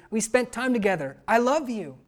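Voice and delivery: normal talking voice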